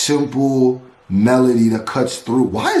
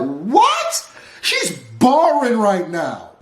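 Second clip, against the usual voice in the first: high, incredulous outburst; amazed; 11 semitones higher, slower than usual. Real, not acted. Reactor stunned by the singer's lyrics, amazed. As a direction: marvel at it, stretching the high notes.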